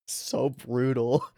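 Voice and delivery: soft, slow chuckle through the words; laughing. Real, not acted. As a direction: laugh it out quietly. Laughing at the brutal line.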